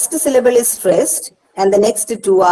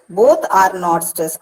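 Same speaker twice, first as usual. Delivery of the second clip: usual speaking voice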